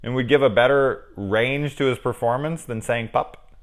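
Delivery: ordinary talk, nothing marked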